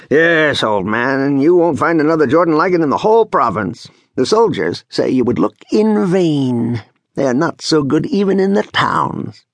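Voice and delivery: excitable, self-satisfied talk; boastful village braggart. Acted. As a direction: boast proudly to an old man. Voices boastful character in story.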